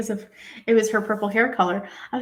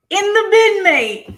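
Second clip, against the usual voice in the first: loud, high mock British bark; quoting Simon in a London accent; shouted cockney impression; 11 semitones higher, 9 dB louder, slower than usual. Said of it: "In the words of Simon".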